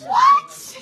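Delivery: high, loud wail of protest; hammed-up squawk, then high, aggrieved protest; outraged disbelief. Refused a detox bed, comically outraged.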